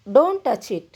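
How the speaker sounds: normal talking voice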